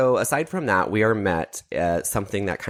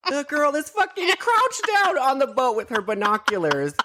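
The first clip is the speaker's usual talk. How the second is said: high, laugh-choked retelling; cracking up while talking, pitched high; laughing incredulously. Real, not acted. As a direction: squeal it out through real laughter. Cracking up over absurd scene.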